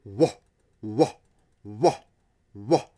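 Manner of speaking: low, echoing wah sounds; imitated woof. Shown as a demonstration. Teacher imitates a woofy guitar with low wahs. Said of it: "the woof is like this".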